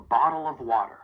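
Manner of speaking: "bottle of water" with the L running into "of"; linked words. Shown as a demonstration. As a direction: join "bottle of water" into one smooth phrase. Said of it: "link that dark L in bottle".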